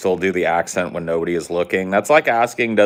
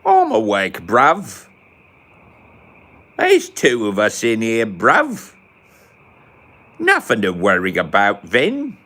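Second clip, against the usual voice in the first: a hint of a cockney bruv voice, a touch louder; mock London accent; 4 semitones higher, 4 dB louder, slower than usual. Gamer answering the only British guy in chat, in a light London accent. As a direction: lightly play a London lad. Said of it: "only British dude awake here".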